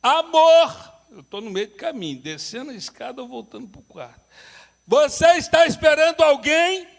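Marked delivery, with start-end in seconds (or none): 0.0-0.9 calling out. Retold or imitated. Husband calling wife again. 4.9-6.9 calling out. Retold or imitated. Husband calling again upstairs.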